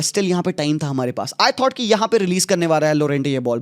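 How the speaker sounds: usual speaking voice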